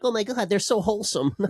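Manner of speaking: high, gushing mock fan voice. Mimicking fans who gush over the player, high and gushing. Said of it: "everyone's like".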